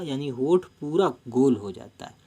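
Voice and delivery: ordinary talk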